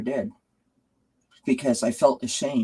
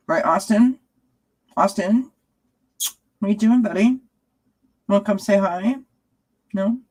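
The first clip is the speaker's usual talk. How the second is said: much higher sing-song voice calling the cat; pet talk; 9 semitones higher than usual. Real, not acted. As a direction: coax the cat over, high and sweet.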